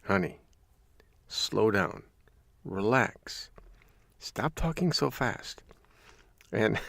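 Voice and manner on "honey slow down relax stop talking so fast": soft, spaced-out soothing phrases; exaggerated patience, one phrase at a time; calm. Husband asking wife to stop talking fast, calm. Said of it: "take a deep breath and say".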